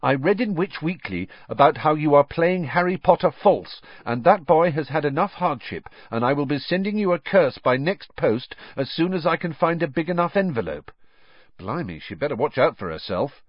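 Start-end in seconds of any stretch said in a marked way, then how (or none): 0.0-10.9 raised, louder mock-earnest reading; a touch of a self-righteous letter writer; Ron reading letter. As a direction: read the threat with relish. Ron reads letter aloud.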